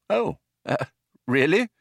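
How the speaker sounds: hesitant, high-pitched politeness; stall, then answer uncertainly; disconcerted. A narrator's reading. Arthur disconcerted by the man.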